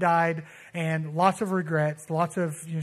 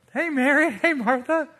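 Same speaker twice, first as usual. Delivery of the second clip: high, louder mock hello; cheery greeting; 7 semitones higher, 4 dB louder than usual. Jesus arrives and greets the sisters, cheery greeting. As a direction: call out a light, lifted greeting.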